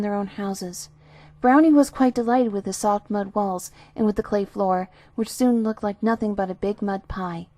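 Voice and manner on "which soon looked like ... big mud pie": ordinary delivery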